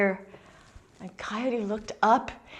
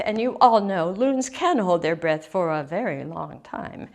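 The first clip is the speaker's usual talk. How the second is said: ordinary delivery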